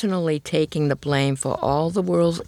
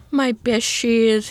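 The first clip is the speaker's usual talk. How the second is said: slow, higher moan of dismay; mock moan; 8 semitones higher, 3 dB louder, slower than usual.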